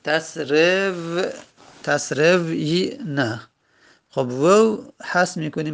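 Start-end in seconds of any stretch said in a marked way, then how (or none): 0.1-3.4 تسروینا very slow, as tas-rev-yi-na; broken into syllables. Shown as a demonstration. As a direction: hold the v of تسرو before finishing تسروینا.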